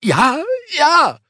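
high, pained groan of a beaten man; groaning Sebastian. A narrator's reading. Sebastian on the ground answering the giant, high and pained. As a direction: whine it out in pain. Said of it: "stöhnte Sebastian".

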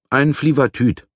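a hint of a proud young inventor; boy announcing his invention. A narrator's reading. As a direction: announce it high, falling at the end.

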